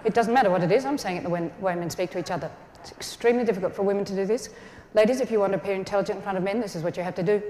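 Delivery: low, quiet, level drone; deadpan, low, soft and brisk monotone; man-speak monotone. Shown as a demonstration. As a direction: imitate men talking, no movement in the voice. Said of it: "They speak in a monotone".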